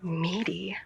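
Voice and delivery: slow exasperated whisper; slow whispered aside. Acted. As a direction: breathe it out slowly under your breath. Caller whispering complaint about partner.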